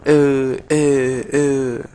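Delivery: "eh" as eu with the tongue inside; "eh" said eu, mouth closed, tongue in; closed "eu" vowel. Shown as a demonstration. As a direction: hold each "eh" as a firm, loud eu. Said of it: "y la lengua adentro".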